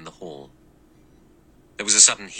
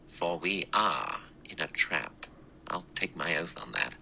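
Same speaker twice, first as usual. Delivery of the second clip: slow and softer, weighing each word; said slowly; 7 dB quieter than usual. Acted. Lombard slowly admitting they're trapped, slow and soft. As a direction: let the certainty sink in slowly. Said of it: "He said slowly".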